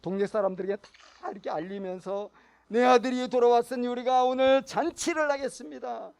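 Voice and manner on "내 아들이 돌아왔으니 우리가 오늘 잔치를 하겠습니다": loud, high announcement of a feast; proud announcement. Retold or imitated. Father announcing the feast to the villagers, loud and proud. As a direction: call out the celebration to the whole village.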